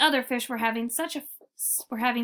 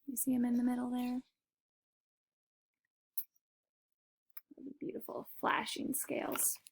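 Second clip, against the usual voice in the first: hushed aside; 10 dB quieter than usual. Real, not acted. Reader whispering to a child about the picture. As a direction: softly point to the picture.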